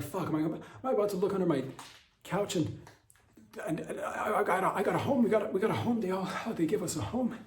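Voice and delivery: high, laughing, rushed giddy voice; giddy excitement. Retold or imitated. Comic dreaming of Iran's gift under his couch, giddy high laughing. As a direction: squeal with delight over a free home. Imagining Iran gifting homes.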